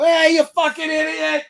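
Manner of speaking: high, loud, drawn-out heckle; mock-holler it like out a car window; imitated window yell. Friend heckling strangers from a car, yelled high. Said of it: "Duhop would yell out the window".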